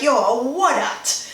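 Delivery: slow, drawn-out disbelieving "Yo, what at?"; flabbergasted. Retold or imitated. Seeing someone do something unbelievable, slowly stunned. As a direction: stretch it out in disbelief.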